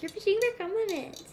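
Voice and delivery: high mocking sing-song voice; mocking quote.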